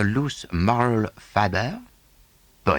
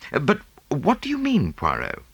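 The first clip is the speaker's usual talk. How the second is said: lightly voiced young Englishman, higher; Hastings voice; 10 semitones higher than usual. Hastings objecting to Poirot, lightly voiced. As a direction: Hastings brightly cuts in.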